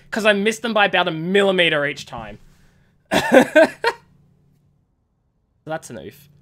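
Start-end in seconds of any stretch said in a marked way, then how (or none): 3.1-4.0 high, loud laugh; burst of laughter. Real, not acted. Player laughing at own bad aim, high loud laugh.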